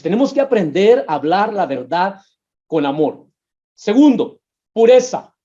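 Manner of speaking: ordinary talk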